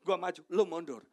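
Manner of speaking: loud, pushy egoist; egotistical. Acted. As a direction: shove others aside with the voice, loudly. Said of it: "Itu gaya berenang orang egois". (gaya orang egois, lantang mendesak)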